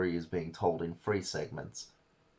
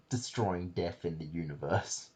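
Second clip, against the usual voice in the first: normal talking voice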